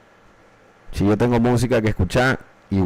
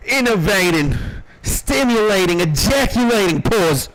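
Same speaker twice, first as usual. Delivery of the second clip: each word climbing higher, ending in a shout; building hype list; 9 semitones higher than usual. Pretend. Streamer riffing boasts to chat, rising to a mock shout. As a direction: ramp up the brag word by word.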